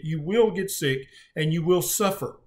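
ordinary talk

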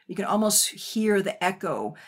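ordinary talk

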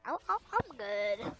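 usual speaking voice

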